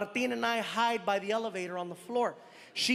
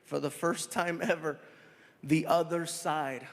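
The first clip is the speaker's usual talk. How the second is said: stage host bringing a friend out to the crowd; announcer intro; 5 semitones lower than usual. Acted. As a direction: build up the guest like a host. Introducing guest.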